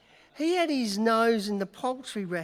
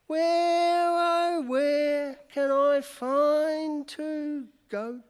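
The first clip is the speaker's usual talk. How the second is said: mock lament; 7 semitones higher, 5 dB louder, much slower than usual. Butler wails for the goats.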